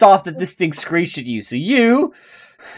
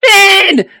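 sharp, much higher and louder shout of the name; calling out loud; 7 dB louder than usual. Real, not acted. GM shouting for a player who went silent, loud call. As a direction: yell the name to wake him up.

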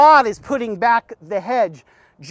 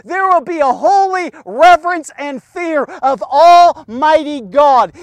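normal talking voice

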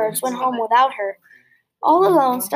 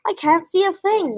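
yelled; 7 semitones higher than usual. A narrator's reading. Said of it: "yelled Flip".

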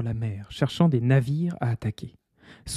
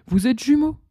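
high, loud announcement; revelation; 10 semitones higher, 5 dB louder than usual. Acted. Queen telling her sons they are twins, high. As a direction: reveal it with emotion.

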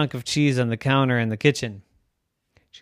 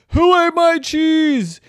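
very high, loud, slow outraged demand; indignant human; 12 dB louder, slower than usual. Acted. Human owner demands who ate the cheese, high, loud and slow. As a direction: drag out the outrage.

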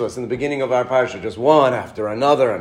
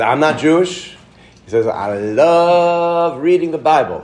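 put-on Southern accent; 3 dB louder than usual. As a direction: lightly play the Southern man. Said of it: "in his great Southern accent".

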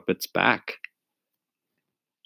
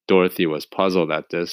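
ordinary delivery

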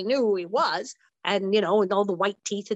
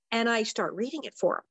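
ordinary delivery

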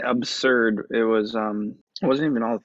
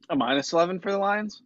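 surprised; 8 semitones higher, faster than usual. Real, not acted. Disbelieving question about the bet.

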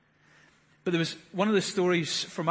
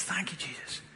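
much softer, barely voiced thanks; soft whisper; 9 dB quieter than usual. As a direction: let the words fall to a hush. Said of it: "she'd be whispering this".

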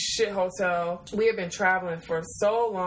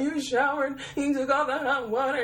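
mock crying; 7 semitones higher than usual. Crying at her cousin over the cold water, high and whiny. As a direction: wail at the cold shower like a wronged child. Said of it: "I was, like, crying".

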